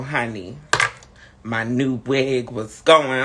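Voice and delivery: usual speaking voice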